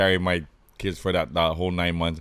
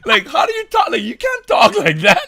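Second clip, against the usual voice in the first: very high, slow, laughing-crying disbelief; incredulous laughter; 4 dB louder than usual. Retold or imitated. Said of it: "And I'm like".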